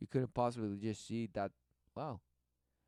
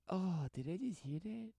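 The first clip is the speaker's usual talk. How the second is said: much higher, slow, sing-song mock cheer; mock airy woman; 9 semitones higher, 4 dB louder, slower than usual. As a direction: parody a woman faking delight. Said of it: "And she's like".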